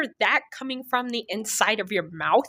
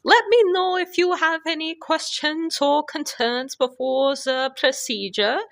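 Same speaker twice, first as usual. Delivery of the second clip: put-on Eastern European accent; 8 semitones higher than usual. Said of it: "Miss Eastern European".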